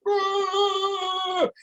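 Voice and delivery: high, mocking "Boo!" jeer. Protesters on the desk booing, a high mock jeer. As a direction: jeer like a kid in a crowd. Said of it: "standing on the desk going".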